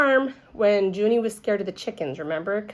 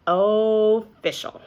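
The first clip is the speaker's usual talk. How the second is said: slow, drawn-out official; 2 semitones higher, much slower than usual. Acted. Child repeating the word slowly to herself.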